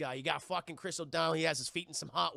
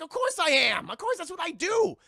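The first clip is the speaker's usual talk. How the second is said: much higher, much louder gleeful gloat; gloating villain; 9 semitones higher, 8 dB louder than usual. Retold or imitated. Woodrue admitting his weapons, loud hammed gloat. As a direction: crow it proudly. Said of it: "And he's like".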